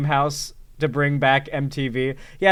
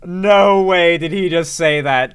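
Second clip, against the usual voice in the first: loud, stretched incredulous exclamation; loud disbelief; 2 semitones higher, 7 dB louder, slower than usual. Real, not acted. Streamer reacting to the accused man's denial, loud disbelief. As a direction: draw out the disbelief loudly. Astonished reaction after laughing.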